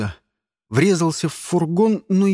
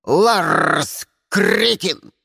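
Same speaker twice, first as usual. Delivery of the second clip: raven voice; 4 dB louder, much slower than usual. Acted. Raven keeps cursing the agents.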